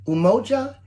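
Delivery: "Umoja" said clearly as oo-MO-ja, loud and high; Swahili word demo. Shown as a demonstration. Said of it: "Can you say "umoja"?".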